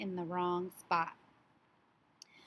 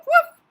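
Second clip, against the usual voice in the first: very high, very loud woof; dog bark; 16 dB louder than usual. Acted. Teacher barking as the dog for children, very loud woof. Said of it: "along comes the woof".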